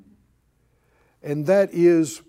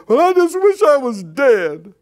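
loud, higher exaggerated wail; hammed-up self-pity, pitch swooping up then down; mock complaint; 4 semitones higher, 6 dB louder than usual. Preacher mocks believer under pressure, hammed-up complaint.

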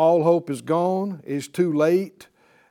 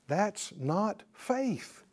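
ordinary talk, nothing marked

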